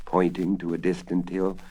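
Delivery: usual speaking voice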